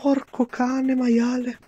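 high, slow drawn-out swearing; explosive italian cursing. Real, not acted. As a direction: stretch out each curse word. Gamer curses after failing.

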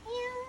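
high meow like a kitten; meow imitation. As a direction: mew back at the cat.

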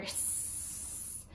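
long soft snake hiss; boa constrictor hiss. Shown as a demonstration. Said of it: "Hissing like a boa constrictor?".

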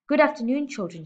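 loud barking hello, punching good; barked greeting. A narrator's reading. Headmistress barking a greeting at her class. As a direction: bark it at the class like a drill sergeant. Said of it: "she barked".

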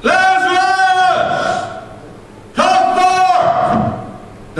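very high, drawn-out ringing call; calling a dead man from the grave; loud holler. Acted. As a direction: slowly holler the name across the tomb. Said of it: "he hollered with a loud voice".

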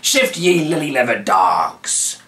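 slow, loud pirate growl; pirate ordering prisoners out; pirate bark. A narrator's reading. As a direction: roar at the dogs to move. Pirate shoves prisoners along.

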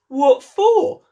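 high, loud indignant squeak; startled question. Acted. Gardener Two startled at being told he'll be beheaded, high squeak. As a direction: jump up in alarm at the news. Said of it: "said the one who had spoken first".

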